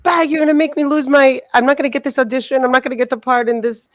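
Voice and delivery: high-pitched, frantic imitated ranting; mock freak-out. Imitating the actress afraid of losing the part, mock freak-out. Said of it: "so freaking, uh, out of control".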